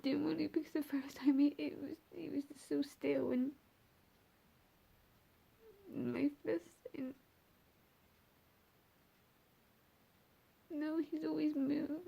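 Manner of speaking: high, grief-stricken voice with halting pauses; real tears rising as you recall it; tearful. Real, not acted. Mother remembering her still baby on screen, tearful.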